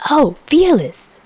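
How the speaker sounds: high, slow excited cry; light up at the song. Acted. Chuya hearing his favorite song come on, high and excited.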